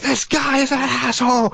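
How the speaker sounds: high-pitched, exasperated cry; mock angry outburst. Gamer venting at whoever keeps killing him, mock shout. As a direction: let the held-back rage out, hammed-up. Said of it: "But really, you wanna be like".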